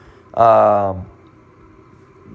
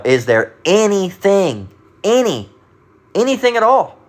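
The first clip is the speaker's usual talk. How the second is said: "anything" slow, raised and emphatic; stress on ANYTHING; 7 semitones higher than usual. Shown as a demonstration. Preacher corrects the reading for listeners, emphatic rising stress on "anything". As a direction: punch "any" and "anything" higher each time. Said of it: "how that would be pronounced in, in italics".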